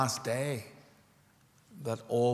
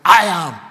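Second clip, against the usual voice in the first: "I am" thundered, much louder and higher; emphatic I AM; 6 semitones higher, 16 dB louder than usual. Acted. Preacher voicing Jesus to Martha, emphatic shouted "I am". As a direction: punch out "I am" at full force.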